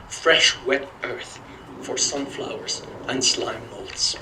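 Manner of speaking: slow, deliberate poetry recital; poem reading. Acted. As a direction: read each image slowly, savoring it. Reads his mentor's poem aloud.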